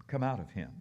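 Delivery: a hint of a low commanding voice; firm order to the spirit; stern command. Preacher voicing Jesus ordering the demon out, lightly low.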